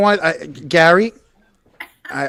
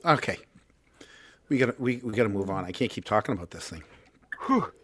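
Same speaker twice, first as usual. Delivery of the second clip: out of breath; 8 semitones lower, 8 dB quieter than usual. Real, not acted. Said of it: "while you're hyperventilating over there".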